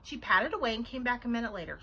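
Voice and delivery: ordinary delivery, nothing marked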